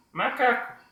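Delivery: high puppet voice saying "macaco" in one quick run; high puppet, syllables run together; unseparated puppet speech. Shown as a demonstration. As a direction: say "macaco" straight through as the puppet, without breaks. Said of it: "e não assim".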